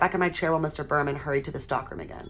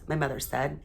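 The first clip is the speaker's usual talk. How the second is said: ordinary delivery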